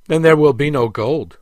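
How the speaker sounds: defiant prince standing up to the overseer; defiant declaration. A narrator's reading. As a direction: plant your feet and refuse. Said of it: "declared the prince".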